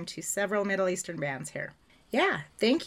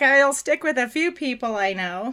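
laughing; 3 semitones higher, 8 dB louder than usual. Real, not acted. Laughing at the nickname.

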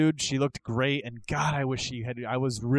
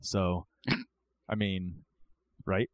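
dry sarcasm, trailing lower and softer; sarcastic; 5 semitones lower, 5 dB quieter, slower than usual. Real, not acted. Host joking to co-hosts about a wrestler, sarcastic. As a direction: deliver the mock compliment dryly, then fish for agreement. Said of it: "I'm assuming that's sarcasm".

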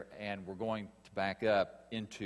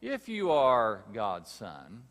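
slow, louder, sly doubting question; tempter's challenge; 8 dB louder, slower than usual. Acted. Preacher voicing the devil's challenge to Jesus, slow and insinuating. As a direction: drag out the doubt in "If". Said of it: "the tempter saying to Jesus".

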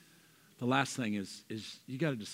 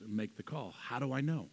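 ordinary talk

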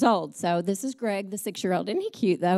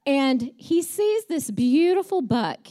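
usual speaking voice

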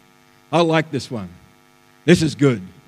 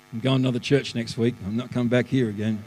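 grumbled aside; 6 semitones lower, 5 dB quieter, faster than usual. Acted. Voices a put-off churchgoer's aside.

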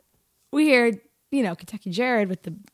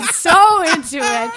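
excited; 8 semitones higher, 8 dB louder, slower than usual. Real, not acted. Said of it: "We're all fucking singing".

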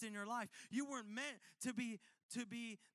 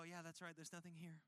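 resigned mutter; 5 semitones lower, 7 dB quieter than usual. Retold or imitated. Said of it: "you go".

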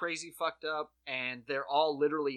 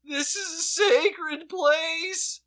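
much higher and louder, slow and drawn out; hammed-up earnest police officer; pleading cop; 13 semitones higher, 11 dB louder, slower than usual. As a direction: beg them solemnly to be reasonable. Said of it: "he's literally like".

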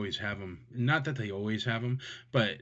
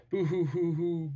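fake crying; 8 semitones higher, 3 dB louder than usual. Mock sobbing over not owning one.

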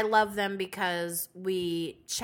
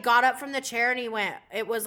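normal talking voice

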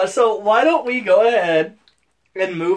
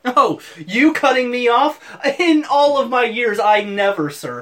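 higher, louder hammed-up affront; mock indignation; 5 semitones higher, 4 dB louder than usual. Co-host replying to being cut off, mock indignant. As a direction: act grandly scandalized. Feigned offence at being interrupted.

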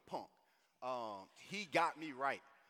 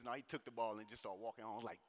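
normal talking voice

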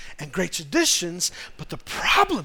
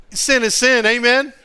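louder, punched sin is sin; louder declaration to the congregation; louder restatement; 4 semitones higher, 6 dB louder than usual. Shown as a demonstration. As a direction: hammer it home louder. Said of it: "I'm sorry".

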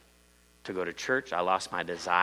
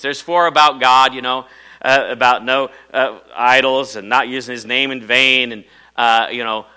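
louder, pressing through the list; loud preaching; 4 semitones higher, 10 dB louder than usual. Acted. Pastor listing commandments to the congregation, loud preaching. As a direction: keep the preaching energy up.

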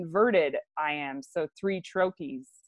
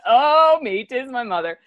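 louder, higher mock gasp on Oh, then settling; hammed-up shock at spotting mother; mock startled gasp; 5 semitones higher, 6 dB louder than usual. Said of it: "I love the".

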